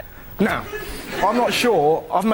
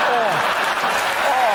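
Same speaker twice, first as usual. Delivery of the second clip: stunned preacher; 6 semitones higher, 5 dB louder than usual. Retold or imitated. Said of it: "he just sat there going".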